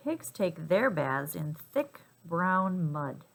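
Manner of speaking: ordinary delivery, nothing marked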